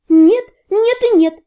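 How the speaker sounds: loud, high, panicked cry; frightened shout. A narrator's reading. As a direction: yell it in alarm. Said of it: "заорала я с таким испугом и страстью".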